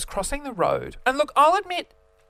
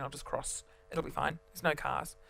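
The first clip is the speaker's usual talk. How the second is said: muttering; 4 semitones lower, 10 dB quieter than usual. Retold or imitated. Muttered to self.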